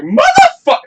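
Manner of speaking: a loud, very high yelled curse; retold outburst. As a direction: blurt it out like the end of a skit. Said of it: "I did Chewbacca".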